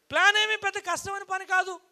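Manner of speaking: high, loud proclamation; loud declaration. Real, not acted. Speaker selling the plan as easy, high loud declaration. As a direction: proclaim it to the whole hall.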